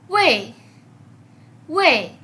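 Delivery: "wei" falling sharply from high, loud; cuarto tono. Shown as a demonstration. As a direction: start "wei" high and drop it fast. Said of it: "este cuarto tono". («wei» en cuarto tono, cayendo de agudo a grave)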